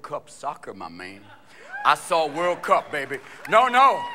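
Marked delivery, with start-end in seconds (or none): none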